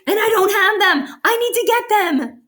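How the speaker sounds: high, excited outburst; eager. Real, not acted. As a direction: blurt it out like you must have them. Reader longs for unread books.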